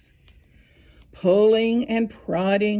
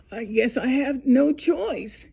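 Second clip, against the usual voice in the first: ordinary delivery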